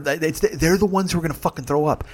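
ordinary delivery

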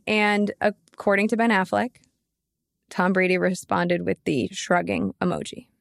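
ordinary talk, nothing marked

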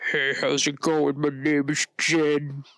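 low, slow booming voice; deep baritone voice. Shown as a demonstration. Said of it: "I'm a shouty baritone".

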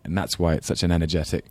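ordinary delivery

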